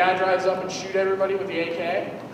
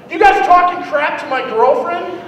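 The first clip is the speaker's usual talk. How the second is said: angry; 6 semitones higher, 4 dB louder than usual. Retold or imitated. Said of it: "he's just like".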